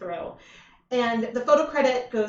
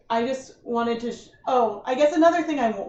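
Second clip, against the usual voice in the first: normal talking voice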